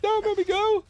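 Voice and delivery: high, pleading mock wail; staged battle cries. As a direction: whine it like a dragged-off child. Mock kicking and screaming.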